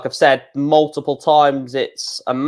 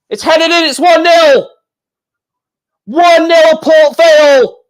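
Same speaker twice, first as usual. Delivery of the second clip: high, loud shout of a goal; goal call; 13 semitones higher, 8 dB louder than usual. Real, not acted. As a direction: shout the goal out, stretching the last words. Commentator calls the goal.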